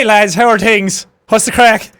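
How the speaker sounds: loud, drawn-out mimicked Cork accent; Cork Irish accent. Streamer echoing the Cork rowers, Cork accent. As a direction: echo the Cork lads with relish. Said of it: "hear a real fucking Irish accent".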